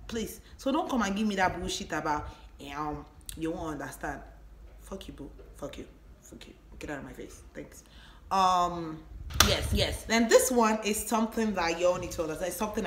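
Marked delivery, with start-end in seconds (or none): 4.8-7.8 cold quiet dismissal. Acted. Dismissing the boyfriend.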